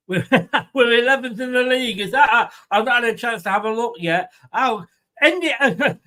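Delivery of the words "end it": slow, hammed-up presidential impression; mock politician's demand, drawn out; Donald Trump. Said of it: "here's my Donald Trump impression".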